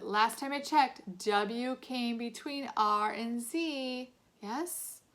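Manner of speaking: slow, pointed teacher sarcasm; sarcastic scolding. A narrator's reading. Teacher scolding a boy in the wrong row, slow and sarcastic. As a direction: spell it out slowly to show he's wrong.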